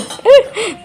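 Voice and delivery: high burst of real laughter; bright giggle breaking out. Real, not acted. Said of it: "मुझे इतनी हंसी आ रही थी".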